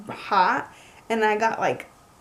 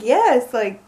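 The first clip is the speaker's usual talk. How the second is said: excited yes; 7 dB louder, much slower than usual. Retold or imitated. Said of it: "I was just like".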